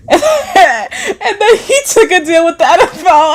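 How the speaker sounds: high, slow words squeezed through laughter; incredulous laughing delivery. Real, not acted. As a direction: crack up while saying it. Laughing at the deal's irony.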